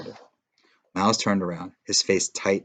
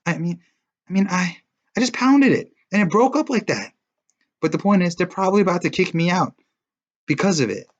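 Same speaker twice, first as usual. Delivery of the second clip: high, louder agitated pleading; upset defense; 10 semitones higher, 5 dB louder than usual. A narrator's reading. Boy defends himself, high and agitated. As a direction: defend yourself, rattled.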